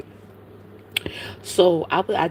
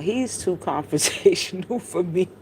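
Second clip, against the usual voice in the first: laughing. Real, not acted. Laughs while admitting she avoids him.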